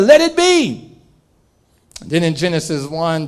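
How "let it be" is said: booming command. Acted. God's command voiced high.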